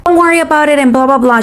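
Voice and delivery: high, put-on dismissive voice; mock teenage retort. Teenager brushing off her questions, high mocking imitation.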